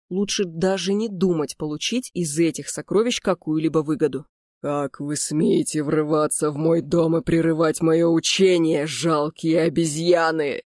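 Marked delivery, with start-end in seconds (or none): 4.6-10.6 slow, menacing skeletal sorcerer; inhuman voice. Acted. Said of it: "Раздался нечеловеческий голос".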